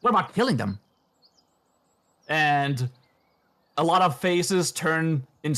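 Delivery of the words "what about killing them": lightly voiced sly cultist; cultist puppet. Cultist puppet suggesting murder, with a hint of menace. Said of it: "the cultist says".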